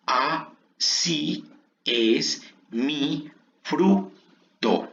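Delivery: very slow, each syllable pulled apart; syllable-by-syllable reading. Shown as a demonstration. Teacher reads poem to class, syllable by syllable. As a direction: stretch the verse out, one syllable at a time. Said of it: "la métrica que yo hice con mi voz".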